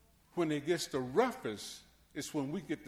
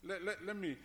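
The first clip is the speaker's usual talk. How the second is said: ordinary talk, nothing marked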